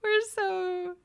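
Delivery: high, giggling words; laughing. Real, not acted. Host to co-host: giggling.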